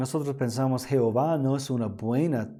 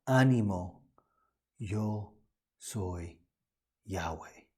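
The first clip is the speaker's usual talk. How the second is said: softer, each word set apart with pauses; solemn revelation; 5 semitones lower, 7 dB quieter, slower than usual. Acted. Preacher voicing Jesus revealing himself, hushed and solemn. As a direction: drop the voice and let "yo", "soy", "Yahweh" land slowly. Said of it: "pero Jesús está diciendo aquí".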